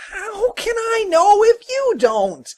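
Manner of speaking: very high, loud wail; high-pitched exasperated cry; complaining. Acted. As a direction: whine in aggrieved protest. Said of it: "he complained".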